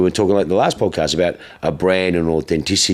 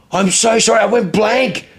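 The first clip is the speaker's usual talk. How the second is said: high-pitched, embarrassed apology; retold flustered apology; 3 dB louder than usual. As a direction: re-enact pleading to be forgiven. Acts out his embarrassed apology.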